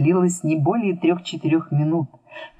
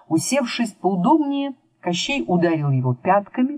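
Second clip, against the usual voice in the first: ordinary delivery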